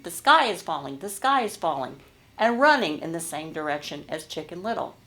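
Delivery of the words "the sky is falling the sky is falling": ordinary talk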